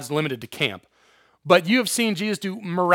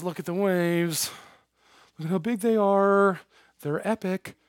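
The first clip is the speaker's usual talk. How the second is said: mock awed dread; 6 dB quieter than usual. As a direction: draw it out as Peter transfixed by danger. Peter distracted by the huge waves.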